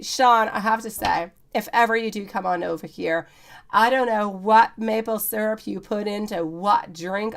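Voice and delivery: usual speaking voice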